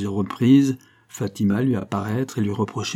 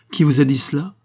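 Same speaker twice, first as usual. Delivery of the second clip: startled cry, raised and higher; exclamation; 5 semitones higher, 6 dB louder than usual. Acted. Don José, jolted, cries out at the woman. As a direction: jump up and demand who told her. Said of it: "s'écria-t-il".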